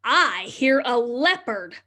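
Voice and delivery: slow, sing-song picture book reading; reading to children. Acted. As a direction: read the refrain slowly to little ones. Reads the rhyming book refrain.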